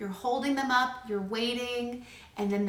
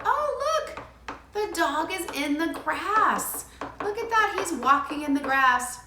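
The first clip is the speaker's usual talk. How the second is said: much higher, slow, sing-song delight; parallel talk; 6 semitones higher than usual. Shown as a demonstration. Parent narrating the child's play, high excited sing-song. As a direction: narrate the dog's walk with wonder. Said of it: "you'll go".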